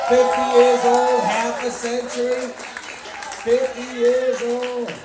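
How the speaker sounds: loud, drawn-out announcement; belted out slow and loud; loud proclamation. Real, not acted. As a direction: shout the milestone out slowly to the crowd. Announces milestone birthday to crowd.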